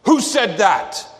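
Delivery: loud, high, drawn-out angry demand; furious scream. Retold or imitated. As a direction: roar it at a crowd, demanding the culprit. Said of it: "he screamed".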